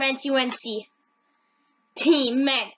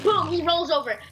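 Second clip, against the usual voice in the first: normal talking voice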